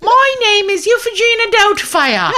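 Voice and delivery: high, hooting put-on accent; Euphegina Doubtfire impression. Prank call-center worker answering the next caller, hammed-up old-nanny voice. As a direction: trill a loud, hammed-up hello. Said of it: "change my accent all the time".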